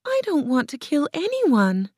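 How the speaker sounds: put-on high, girlish protest; distressed cry. A narrator's reading. Dorothy refuses to kill, high and dismayed. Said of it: "Dorothy cried".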